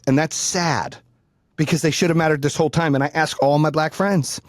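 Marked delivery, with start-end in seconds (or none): none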